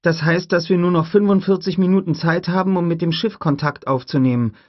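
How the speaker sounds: put-on an eager kid asking; young boy voice. Tim asks Grant about breeding, high boy voice. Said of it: "sagte Tim".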